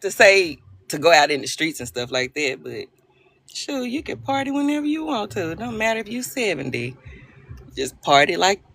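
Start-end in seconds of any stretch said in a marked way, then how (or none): none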